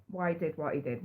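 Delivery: usual speaking voice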